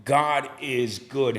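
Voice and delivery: drawn-out testimony line; slow preaching refrain. Acted. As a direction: let each word land slowly.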